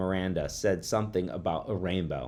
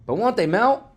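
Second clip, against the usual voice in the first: high, loud schoolboy voice; loud insistent question; 8 dB louder than usual. A narrator's reading. Boy doubting classmate's ice test, loud insistence. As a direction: press the question, pitched right up. Boy pipes up loudly again.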